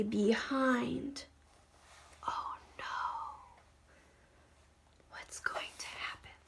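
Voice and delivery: breathy suspense whisper; scared little whisper. Real, not acted. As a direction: hush it like something's sneaking up. Suspense aside while reading.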